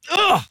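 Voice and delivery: sharp, high groan of exasperation; an exasperated high ugh. Retold or imitated. Exasperated at the people's complaining.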